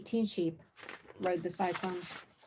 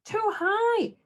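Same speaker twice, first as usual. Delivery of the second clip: much higher, louder frightened shout; 13 semitones higher, 4 dB louder than usual. A narrator's reading. Cyclops on the ride, high panicked shout. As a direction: cry out in alarm. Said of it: "Cyclops shouted".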